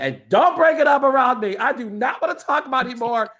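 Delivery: imitating Sutton. Cast member recaps Sutton refusing to discuss it, mock indignant. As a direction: raise the pitch and push out the retold protest. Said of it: "where Sutton said".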